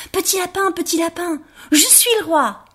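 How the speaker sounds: high, proud little rabbit calling out to the others. Acted. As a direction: announce it to the whole clearing. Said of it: "et il cria".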